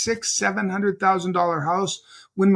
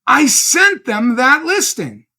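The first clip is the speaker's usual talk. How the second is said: slow, raised, emphatic retold protest; outraged disbelief; 5 semitones higher, 6 dB louder, slower than usual. Agent to his lender about the lost sale, outraged. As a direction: hammer out each word in disbelief.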